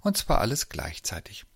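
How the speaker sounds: ordinary delivery, nothing marked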